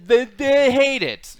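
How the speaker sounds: hammed-up hesitant verdict; mock stammered verdict. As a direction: drag it out, mock-flustered. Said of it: "I'm gonna be like".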